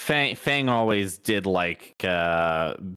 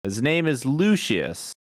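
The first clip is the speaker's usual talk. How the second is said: courteous, higher, formal character voice; polite adventurer; 5 semitones higher than usual. Acted. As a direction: speak politely to strangers.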